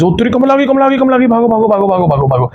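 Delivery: high, frantic cry that she's here; mock-terrified gamer shriek; panicked alarm. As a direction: yelp it out in a sudden fright. Kamla appears, player panics.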